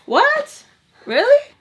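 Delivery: loud, high-pitched disbelief; high, rising, incredulous shout; real surprise. Real, not acted. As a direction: exclaim it in shrieky disbelief. Reacting to partner going to bed.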